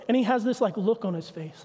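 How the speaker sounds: usual speaking voice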